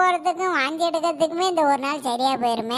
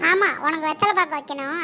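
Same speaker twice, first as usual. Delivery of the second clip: ordinary delivery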